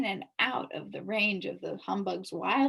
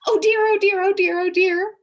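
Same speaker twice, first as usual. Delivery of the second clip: loud, high, anxious oh dears; flustered bee wringing his hands; nervous fretting; 11 semitones higher, 14 dB louder than usual. A narrator's reading. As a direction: fret and panic aloud. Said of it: "the bee repeated, pacing ... nervously".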